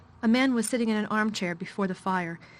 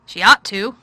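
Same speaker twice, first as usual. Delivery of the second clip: louder, weary commanding man; a tired, gloomy lord giving orders; gloomy master of house; 13 dB louder than usual. A narrator's reading. Craven replies.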